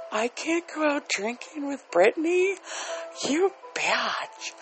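high whiny mock offense, rising; mocking offended person. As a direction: play the easily offended complainer. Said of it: "Everybody's like".

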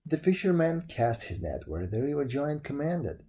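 plain reading voice

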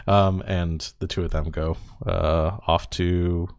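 usual speaking voice

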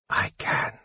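soft, voiceless whisper; barely voiced, hushed reply. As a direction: whisper a quiet assurance. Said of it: "he whispered".

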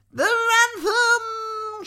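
very high, loud, drawn-out shriek. A narrator's reading. Maudmont watching the ransom spill, long loud shriek. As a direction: shriek it, stretching every word. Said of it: "shrieked Maudmont".

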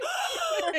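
high theatrical gasp; imitated horrified gasp. Teller acting Simba facing the stampede, imitated gasp. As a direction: gasp like a startled cub.

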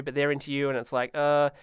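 usual speaking voice

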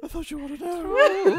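high, loud, whimpering mock-fainting line; Professor Quirrell. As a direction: wail it theatrically, close to laughing. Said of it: "Professor Quirrell".

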